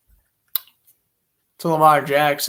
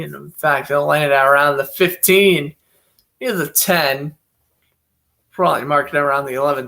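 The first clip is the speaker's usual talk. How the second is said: brisk broadcaster's call of each play; live play-by-play. Acted. Calling the football game live for stream viewers, play-by-play announcer voice. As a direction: call the snap and throw like a TV announcer.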